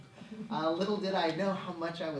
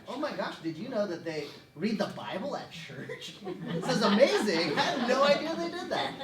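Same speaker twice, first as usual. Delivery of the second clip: hammed-up astonishment, rising higher and louder; amazed excitement; 8 semitones higher, 6 dB louder than usual. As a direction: gush like a teen making a huge discovery. Acting out his teenage amazed discovery.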